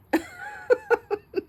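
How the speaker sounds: a burst of high giggling; high laugh. Real, not acted. Laughing high at her niece's husband's photo, high giggle.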